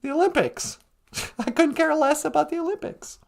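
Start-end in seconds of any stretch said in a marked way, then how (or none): none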